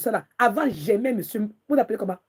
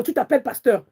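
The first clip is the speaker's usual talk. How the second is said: usual speaking voice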